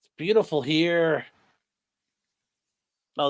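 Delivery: usual speaking voice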